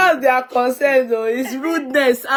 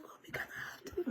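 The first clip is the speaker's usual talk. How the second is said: mock whisper; 24 dB quieter than usual. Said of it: "And he's like".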